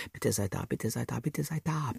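put-on a boy at the witch's door pleads softly, hushed; pleading prayer. A narrator's reading. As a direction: pray under your breath at the door. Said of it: "betete ich".

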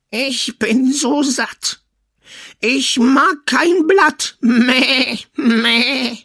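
high, slow bleating goat voice. Acted. The goat tells the son it is full, bleating. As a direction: stretch it out like a goat.

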